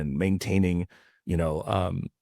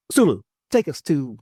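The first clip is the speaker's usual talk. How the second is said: lightly imitated starship captain, raised and louder; William Shatner; 6 semitones higher, 6 dB louder than usual. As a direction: give a higher, louder captain's order. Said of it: "Shatner's like".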